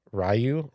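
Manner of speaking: Ryu said as RYE-you; first variant. Shown as a demonstration. Trying out the hero's name, RYE-you pronunciation. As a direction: say "Ryu" starting like rye, questioning. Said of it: "Ryu greater than Ryu".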